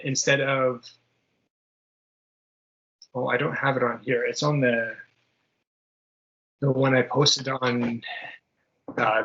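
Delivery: ordinary talk, nothing marked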